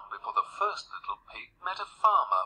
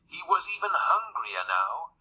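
plain reading voice

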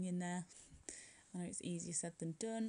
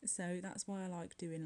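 usual speaking voice

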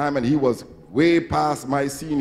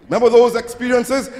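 ordinary delivery

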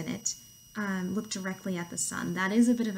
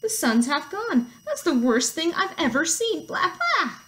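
high, loud, panicked goat voice; hammed-up horrified goat; bleating goat; 7 semitones higher, 6 dB louder, slower than usual. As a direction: exclaim in bleating alarm. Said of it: "bleated Goat".

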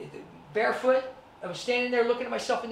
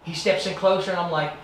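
normal talking voice

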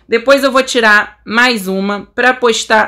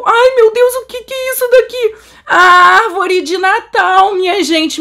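excited astonishment; 9 semitones higher than usual. Real, not acted. Player discovers the Christmas tree for viewers, astonished. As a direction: exclaim high, stretching the big discovery.

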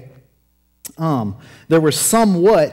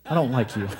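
quiet, laughing sass; sassy refusal; 5 semitones lower, 6 dB quieter, faster than usual. Retold or imitated. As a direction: brush him off with attitude. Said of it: "Now it's like".

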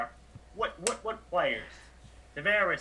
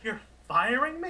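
incredulous question; 7 semitones higher than usual. Acted. Coach told by his manager he is fired, incredulous. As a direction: voice climbing high in disbelief.

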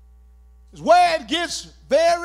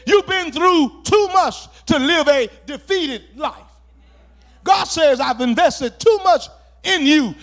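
loud, driving sermon cadence; fervent pulpit shout; preaching at full force; 2 semitones higher, 6 dB louder than usual. Acted. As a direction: preach it hard and rising to the congregation.

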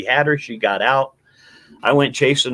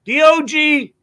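higher called-out spelling; calling out the letters; 3 dB louder, slower than usual. Retold or imitated. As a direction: shout D O G for the dog. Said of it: "I go out calling for D O G".

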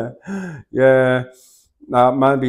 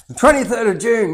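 higher, louder self-correction; correcting the date; 12 semitones higher, 9 dB louder than usual. Real, not acted. Host fixing the gig date for viewers, emphatic. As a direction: land firmly on June.

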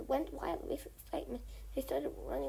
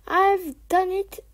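triumphant cry; 6 semitones higher, 15 dB louder, slower than usual. Acted. Fox breaks through triumphant.

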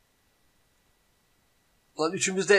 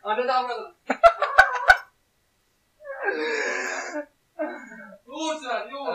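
frantic laughing plea; 8 semitones higher, 3 dB louder than usual. Real, not acted. Desperate for relief from heat.

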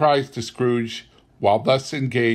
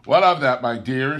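nephew asks; 3 semitones higher, 7 dB louder than usual. A narrator's reading. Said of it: "said Scrooge's nephew".

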